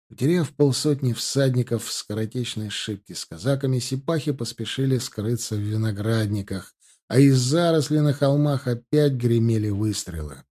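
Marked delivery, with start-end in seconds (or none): none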